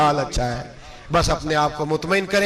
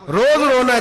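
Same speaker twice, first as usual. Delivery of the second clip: रोज रोना है thrown out higher and louder; rising preacher's fervour; 7 semitones higher, 6 dB louder than usual. Shown as a demonstration. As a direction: hit रोज hard as the refrain peaks. Building sermon list of complaints.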